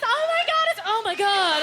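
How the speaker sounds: high, loud panicked oh my God; mock horror at tumbling over; shocked gasp. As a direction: squeal it in alarm as you fall.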